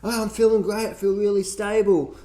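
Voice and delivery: high, airy mock of a smug dieter; mocking paleo dieter. Mocking paleo people who skip blood tests, in a high smug voice. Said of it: "They're like".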